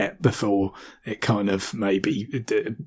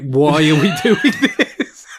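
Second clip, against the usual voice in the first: bewildered quote; 4 semitones higher, 4 dB louder, slower than usual. Retold or imitated. Acted bewildered character quote.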